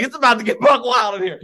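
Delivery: high, loud, gleeful retelling; hyped-up storyteller quote; excited heads-up. Retold or imitated. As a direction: crow it like chaos is about to erupt.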